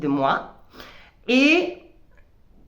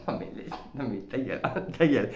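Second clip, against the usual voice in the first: much lower, softer, laughing put-down; cynical ego voice; 10 semitones lower, 9 dB quieter, faster than usual. Retold or imitated. Her ego silencing her with a laughing, low contemptuous voice. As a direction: shut yourself up with mock contempt. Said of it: "j'ai mon ego qui fait".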